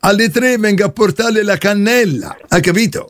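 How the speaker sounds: plumber character voice. Acted. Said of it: "io sono Caio, il trombaio".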